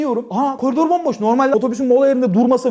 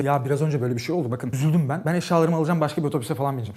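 quiet sheepish plea; 10 semitones lower, 7 dB quieter than usual. Retold or imitated. Apologizing quietly to hostess.

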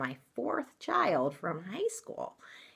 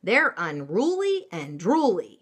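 loud, hammy storybook mama, swooping pitch; dramatic; 3 semitones higher, 7 dB louder than usual. Acted. Mama cat warning kitten off dog school, dramatic. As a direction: punch the key words dramatically. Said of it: "she added dramatically".

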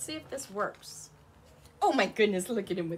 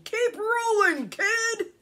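high, loud hearty yell; 12 semitones higher, 13 dB louder, slower than usual. A narrator's reading. Marvin pushes Ricky downhill, yelling. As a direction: yell it after someone going downhill.

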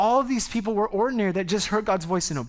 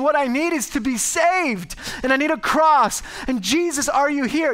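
desperate prayer; 8 semitones higher, 4 dB louder than usual. Retold or imitated. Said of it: "to be humble enough to say".

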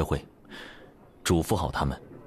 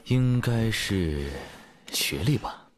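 drawn-out, searching for words; hesitant stalling; 3 semitones higher, much slower than usual. Acted. Boyfriend inventing a reason for her, hesitant and drawn out. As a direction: stretch it out as if still thinking.

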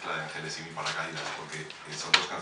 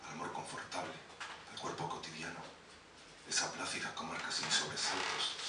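intimate poetry recital with measured pauses; spoken-word poem; 8 dB quieter than usual. Acted. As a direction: recite it line by line, letting phrases hang. Resumes reciting his poem.

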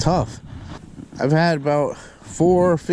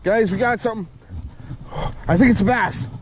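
excited; 7 semitones higher than usual. Real, not acted. Fish hooked, fighting it.

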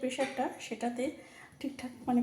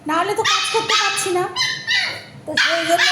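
raised voice over background noise; speaking loudly; 6 semitones higher, 8 dB louder than usual. Real, not acted. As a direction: push your voice over a barking dog. Talking over the barking dog.

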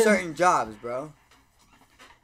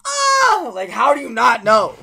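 high, loud, incredulous wail; exasperated cry; 8 semitones higher, 11 dB louder, slower than usual. Retold or imitated. Server mocking his boss's denial, loud disbelieving cry. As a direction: throw up your hands in disbelief. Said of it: "And I was like".

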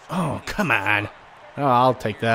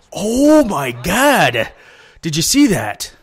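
higher and louder, a sudden "oh my God"; stunned surprise; 5 semitones higher, 5 dB louder, much slower than usual. Real, not acted. Gamer shocked by a hit on screen, high astonished cry. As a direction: gasp out your disbelief at the play.

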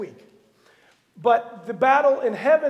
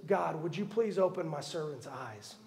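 quiet prayer; 6 semitones lower, 10 dB quieter than usual. Retold or imitated. Elisha prays quietly.

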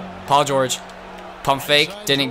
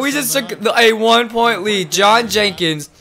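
ordinary talk, nothing marked

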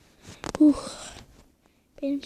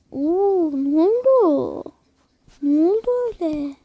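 surprised; 3 semitones higher, 6 dB louder than usual. Real, not acted. Sudden surprise in game.

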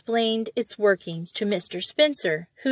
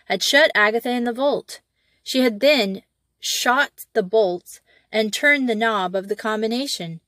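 ordinary delivery, nothing marked

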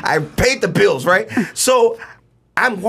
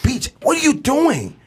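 high-pitched, drawn-out parental scolding; intense; 8 semitones higher than usual. Retold or imitated. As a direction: demand an answer from your son, intensely. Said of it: "he cries at the intensity of".

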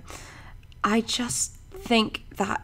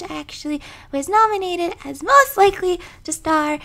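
much higher, light character voice; high-pitched voice; 11 semitones higher than usual. Shown as a demonstration. Voice actor showing off voices to viewers, high-pitched. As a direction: lift the voice right up high and hold it there.

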